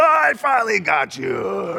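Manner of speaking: hammed-up triumphant shout, high; over-the-top "caught you" cry; mock gotcha. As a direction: shout it like a cartoon catcher pouncing. Mock God tumbling down stairs.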